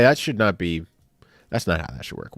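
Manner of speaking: ordinary talk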